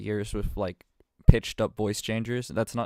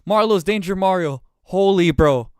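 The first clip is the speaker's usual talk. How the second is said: high, loud mock astonishment; hammed-up shock; 9 semitones higher, 11 dB louder, slower than usual. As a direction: exclaim it like a huge reveal. Hammed-up shock at the read-out reveal.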